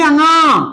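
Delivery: अंगा drawn out through the nose; अंगा with a long nasal ending; nasal sound. Shown as a demonstration. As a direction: sound अंगा slowly in the nose, as a teacher demonstrating. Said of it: "ये नाक से बोले जाते हैं".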